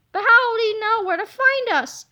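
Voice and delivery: high loud worried question; scared kid voice. Acted. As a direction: ask it in fright. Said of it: "asked yesterday Harold".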